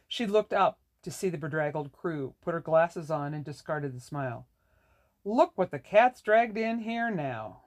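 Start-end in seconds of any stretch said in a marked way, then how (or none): 5.2-7.6 slow and higher, eyes rolling; contemptuous landlady; scornful. A narrator's reading. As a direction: sneer at the bedraggled crew. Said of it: "rolled her eyes".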